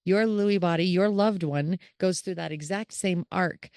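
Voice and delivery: usual speaking voice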